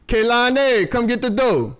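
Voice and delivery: high, sustained summons; calling out. Real, not acted. Interrupting the video to get Kaylani to come, calling out. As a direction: call a name across the house.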